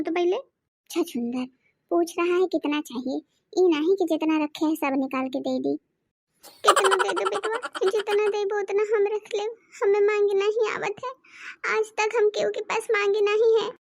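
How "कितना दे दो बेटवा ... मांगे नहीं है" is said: fake crying. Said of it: "अम्मा इतना रोवत है".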